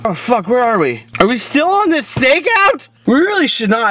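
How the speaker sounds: high startled voice; confused surprise. Acted. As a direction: wake up bewildered. Drunk waking, confused.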